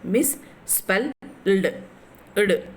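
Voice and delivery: "Misspelled" as mis, spel, ld; slow "Misspelled" with the final "ld" set apart; split into syllables. Shown as a demonstration. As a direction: count out "Misspelled" in three slow pieces. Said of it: "There are the three syllables in the misspelled".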